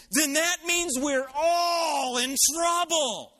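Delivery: high, slow, wailing shout; mimicking a drunk's stretched-out, alarmed holler; loud drunken outburst. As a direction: blurt it out like a drunk for everyone to hear. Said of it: "he went like this".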